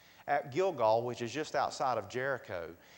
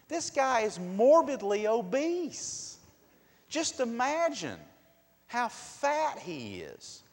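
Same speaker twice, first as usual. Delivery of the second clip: emphatic slow preaching; 10 semitones higher, 7 dB louder, slower than usual. Real, not acted.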